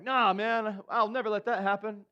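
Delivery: hammed-up loyal bro refusing; mock protest. Peter protests to Jesus.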